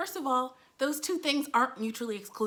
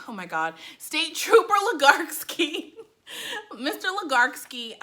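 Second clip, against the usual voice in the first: usual speaking voice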